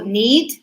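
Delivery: need with the D heard; clear final D. Shown as a demonstration. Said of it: "escucho la D".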